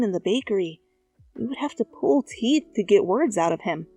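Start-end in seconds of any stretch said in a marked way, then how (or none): none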